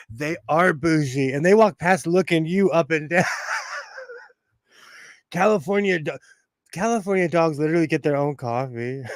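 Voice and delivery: usual speaking voice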